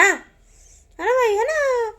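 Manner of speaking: very high, slow sing-song coo. Real, not acted. Auntie cooing to the little baby, high and drawn out.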